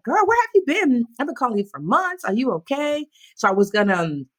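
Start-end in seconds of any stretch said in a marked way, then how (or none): 0.0-3.1 lightly voiced worried girlfriend; friend's worried voice. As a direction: call out to a missing friend. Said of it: "she was like".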